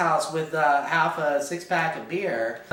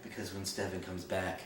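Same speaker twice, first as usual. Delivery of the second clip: low, hushed brooding Damon voice; much lower, quiet mock seduction; imitating Damon; 8 semitones lower, 5 dB quieter than usual. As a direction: drop it low and soft like a smoldering vampire. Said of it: "he's like".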